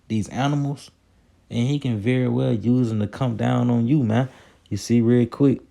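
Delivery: usual speaking voice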